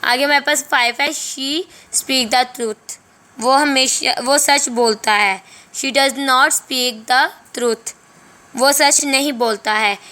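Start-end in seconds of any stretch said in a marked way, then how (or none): 1.1-3.0 slow dictation. Shown as a demonstration. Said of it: "teacher dictating slowly". 5.7-8.0 slow dictation. Shown as a demonstration. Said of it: "teacher dictating slowly".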